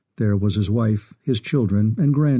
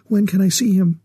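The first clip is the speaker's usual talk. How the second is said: higher-pitched, eager woman's question; hopeful mother, pitched up; 7 semitones higher, 3 dB louder than usual. A narrator's reading. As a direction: ask it eagerly, voice lifted with hope. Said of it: "she asked eagerly".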